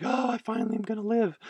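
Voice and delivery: high, soft, quick sigh of relief; relieved desert castaway. Acted. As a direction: lightly voice a stranded man seeing rescue. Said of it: "And he's like".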